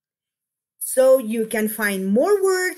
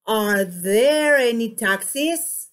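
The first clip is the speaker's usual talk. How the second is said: slow, "there" stretched and "taxis" rising; drawn-out model question, rising on "taxis"; question intonation. Shown as a demonstration. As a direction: lift the end of "taxis" as a question. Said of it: "look at the way I do this".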